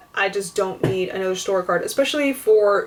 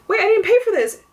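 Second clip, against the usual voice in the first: high-pitched, alarmed objection; retold incredulous protest; startled confusion; 11 semitones higher, 4 dB louder, faster than usual. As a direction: act out a baffled customer catching a mistake. Acting out confusion at the register.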